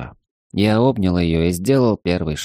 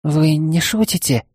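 surprised maid voice; 8 semitones higher than usual. Acted.

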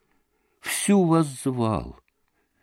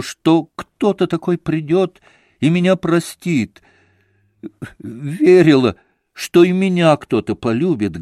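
higher, louder heartfelt outpouring; fervent confession; 5 semitones higher, 5 dB louder than usual. Acted. Kneeling woman confessing to Alyosha, fervent and raised. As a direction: pour it all out to him.

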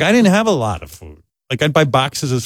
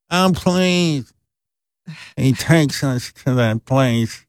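scornful mother; much slower than usual. Retold or imitated. Mother dismissing him.

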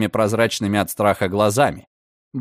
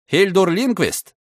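loud call; 6 semitones higher than usual. A narrator's reading. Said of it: "громко крикнул комиссар".

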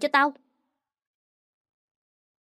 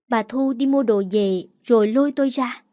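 ordinary delivery, nothing marked

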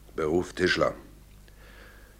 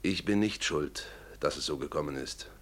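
much softer, trailing lower; subdued disclaimer; 4 semitones lower, 10 dB quieter than usual. Acted. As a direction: deny the blame quietly, voice sinking. Witness disclaims guilt at the end. (leise beteuernd)

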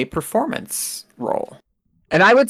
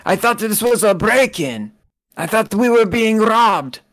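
put-on high, thin voice of an old man; 10 semitones higher than usual. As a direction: play the old kitchen man, pitched high. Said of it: "And he says".

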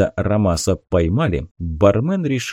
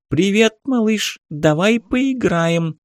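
high squeaky cartoon toy voice, slow; писклявый мультяшный голос; 10 semitones higher, slower than usual. A narrator's reading. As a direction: squeak like a talking kids' toy. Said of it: "писклявым мультяшным голосом заговорил ноутбук". (писклявый мультяшный голос игрушки)

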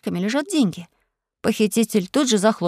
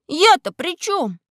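plaintive whine; 6 semitones higher, much slower than usual. A narrator's reading. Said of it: "завопил я жалобно".

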